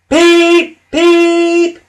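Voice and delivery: very high, loud "peep" blasts; two piercing whistle toots; startling train toot. A narrator's reading. Thomas tooting to wake Percy, loud whistle blast.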